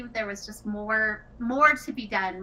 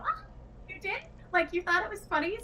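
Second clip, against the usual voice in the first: much higher, hopeful disbelieving question; surprised disbelief; 10 semitones higher than usual. Retold or imitated. Designer answering a fan who loved her game, delighted surprise. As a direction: ask it like you can't quite believe the compliment.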